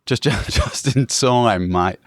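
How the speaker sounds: slow, drawn-out mock Aussie accent; exaggerated Australian twang, stretched out. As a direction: stumble with a laugh into broad Australian. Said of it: "hearing a lot of Australian accents".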